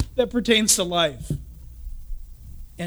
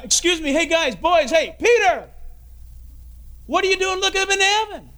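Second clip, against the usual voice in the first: calling out to the disciples; 6 semitones higher than usual. Retold or imitated. Angel calling the gazing disciples, shouting to get attention. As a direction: hail them to turn around.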